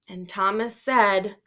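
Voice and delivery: ordinary delivery, nothing marked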